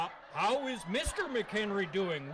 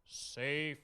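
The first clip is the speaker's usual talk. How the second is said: half speed, sinking lower; slow, measured advice; 5 semitones lower, much slower than usual. Pretend. Santa urges a safe holiday, slow and measured. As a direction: space out each phrase and let it drop.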